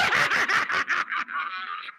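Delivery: high, soft cackle; gleeful cackle; high laugh. Real, not acted. Laughing at his trick.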